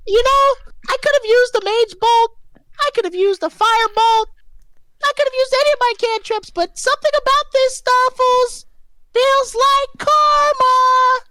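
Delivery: very high, loud squeaky voice; high squeaky character voice. Acted. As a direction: squeak it out like a tiny toy creature. DM voices Stuffles the mage.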